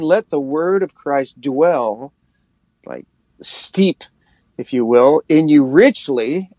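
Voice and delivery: drawn-out, deliberate verse; slow scripture. Acted. To listeners, reading Colossians slowly, letting it steep. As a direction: stretch each word of the verse.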